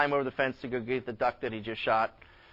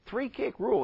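higher-pitched, puzzled echo; puzzled questioning; 9 semitones higher than usual. Acted. Lawyer questioning the farmer's three-kick rule, puzzled. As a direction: query it as if it's news to you.

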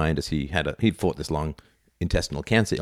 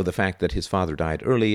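normal talking voice

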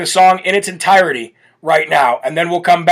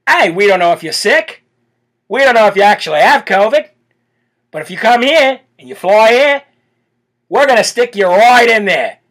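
put-on Australian accent, slightly raised pitch; 3 semitones higher than usual. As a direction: play a mock Aussie official laying down the rules. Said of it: "Love the accent".

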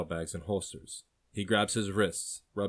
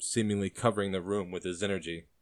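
ordinary delivery

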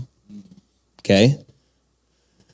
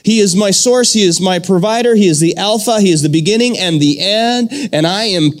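higher, impassioned preaching voice; fervent pulpit declaration; rising sermon proclamation; 4 semitones higher than usual. Acted. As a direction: lift it up like a preacher declaring truth.

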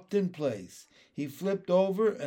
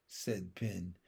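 ordinary delivery, nothing marked

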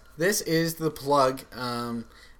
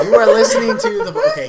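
laughing; 14 dB louder, faster than usual. Real, not acted. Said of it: "no, what was I supposed".

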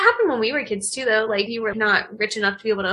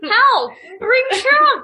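much higher and louder, laughing; excited laugh; 10 semitones higher, 5 dB louder, much slower than usual. Real, not acted. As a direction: blurt it out gleefully.

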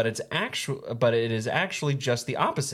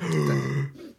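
shocked gasp; 11 semitones lower than usual. Real, not acted. Shocked at bear video.

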